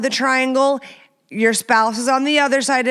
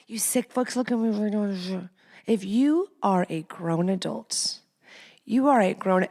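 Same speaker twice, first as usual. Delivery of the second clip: quiet disgust; 7 dB quieter than usual. Real, not acted. Scolds listeners in low voice.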